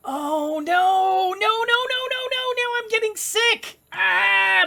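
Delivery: high, rising string of alarm; panicked wail. Real, not acted. Panics as sickness starts.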